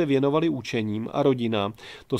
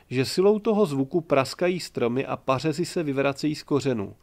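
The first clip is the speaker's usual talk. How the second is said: ordinary delivery, nothing marked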